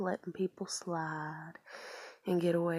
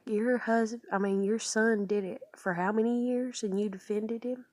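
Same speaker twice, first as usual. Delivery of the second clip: louder, higher, accusing comeback; imagined indignant challenge, raised voice; acted angry retort; 4 semitones higher, 6 dB louder than usual. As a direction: fire back as in a family argument. Imagined quarrel with grandmother.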